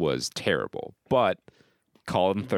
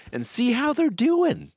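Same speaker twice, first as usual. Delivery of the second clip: silly high voice. Pretend. Host hamming up the follow-up segment in a very high silly voice. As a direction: go way up high, cartoonish.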